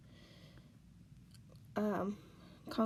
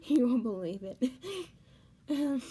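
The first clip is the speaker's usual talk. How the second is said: louder, tearful words breaking through; crying; 3 semitones higher, 6 dB louder than usual. Real, not acted. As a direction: laugh it off but tears win. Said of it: "trying to laugh it off, it's not working".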